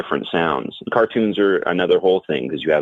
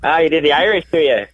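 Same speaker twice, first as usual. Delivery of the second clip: put-on playful Irish accent; 10 semitones higher, 4 dB louder than usual. Answers talk of straying into Irish.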